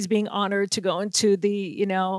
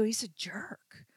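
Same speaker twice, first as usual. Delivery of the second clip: whispered aside about a jerk; hushed warning; 17 dB quieter, slower than usual. Retold or imitated. Reporters whisper a warning in the locker room, hushed. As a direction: drop to a whisper, keep it secret. Colleagues warn quietly about Moss.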